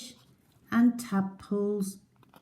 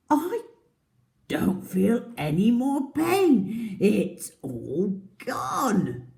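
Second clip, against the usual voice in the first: relieved crocodile; 5 semitones higher, 6 dB louder, slower than usual. Acted.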